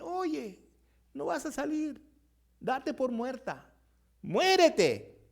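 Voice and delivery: high, taunting frog chorus, the last word loud; mock the frog in the hole; taunting from above; jeering frogs. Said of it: "las otras que están arriba le dicen".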